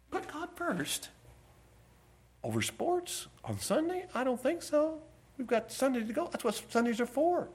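mock incredulous objection. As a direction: scoff in a high, hushed put-on voice. Imagined public objection to Sunday worship.

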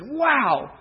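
admiring wonder. Acted. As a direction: marvel at him, voice lifted. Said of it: "He looked at David and said".

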